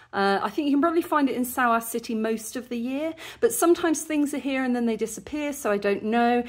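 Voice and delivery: normal talking voice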